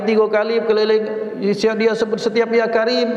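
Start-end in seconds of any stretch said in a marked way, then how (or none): 2.5-3.1 mocking echo. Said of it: "kamu orang ini ngomong".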